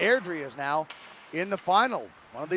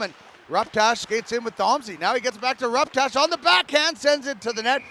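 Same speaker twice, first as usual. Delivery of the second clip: building, higher call as the rush nears the net; excited play-by-play; 5 semitones higher, 4 dB louder than usual. Real, not acted. Commentator calls a rush toward the net, excited. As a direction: lift the pitch with the attack. Live call of a scoring chance.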